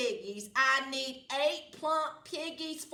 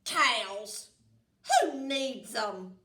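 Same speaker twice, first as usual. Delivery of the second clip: muttered; 7 dB louder, slower than usual. Acted. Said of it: "Gritch muttered".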